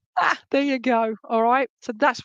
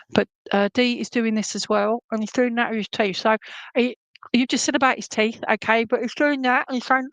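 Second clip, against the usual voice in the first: ordinary talk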